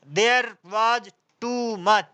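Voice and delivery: very slow, word by word; slow example read. Shown as a demonstration. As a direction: read the example sentence out slowly for the class. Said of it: "teacher reading example slowly". (धीरे धीरे उदाहरण पढ़ना)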